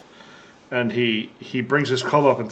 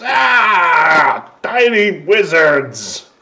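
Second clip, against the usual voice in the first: loud, higher roar falling away slowly; ogre reeling in pain; 5 semitones higher, 6 dB louder, much slower than usual. Acted. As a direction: bellow it like a hurt ogre. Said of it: "He goes".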